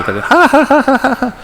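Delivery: gargalhada aguda e lenta, de deboche; risada zombeteira. Retold or imitated. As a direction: rir alto e devagar zombando. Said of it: "E risada". (risada debochada da voz na cabeça)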